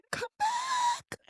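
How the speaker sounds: tiny, very high, strained call; mock dramatic plea. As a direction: squeak out a faint, hammed-up farewell plea. Mock dramatic plea after farewell.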